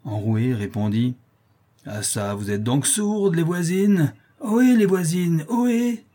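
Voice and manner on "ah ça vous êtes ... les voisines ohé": put-on raucous drunkard calling out, slow then higher and louder; a rough, hoarse brute hollering through the door; vile hoarse drunk. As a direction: bawl at the neighbours like a coarse drunk. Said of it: "Une voix ignoble, rauque, enrouée".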